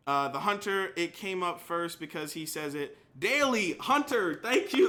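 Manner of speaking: ordinary talk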